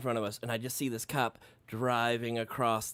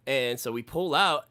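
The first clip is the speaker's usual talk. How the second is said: ordinary talk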